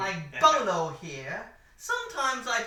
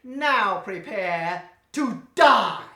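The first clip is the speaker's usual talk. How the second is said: slow and louder, savouring each word; hammed-up evil villain; menacing villain threat; 9 dB louder, much slower than usual. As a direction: loom over the hero with a dramatic death threat.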